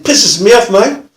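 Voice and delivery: high, loud mock grumble; aggrieved offender's complaint; annoyed. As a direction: imitate a rough bloke who's fed up. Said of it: "why are you annoyed?".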